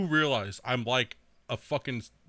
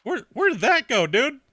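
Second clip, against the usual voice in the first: higher, louder mock disbelief; mock bewildered; 9 semitones higher, 5 dB louder than usual. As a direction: act baffled at the jump. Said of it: "I'm like".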